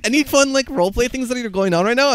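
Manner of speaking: high eager question. Retold or imitated. Quoting Lexi in voice call.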